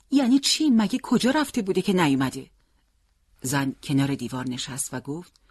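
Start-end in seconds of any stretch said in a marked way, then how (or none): 0.1-2.5 high, louder demanding questions; alarmed disbelief. A narrator's reading. Father questioning wife about missing son, alarmed. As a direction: demand an explanation, taken aback.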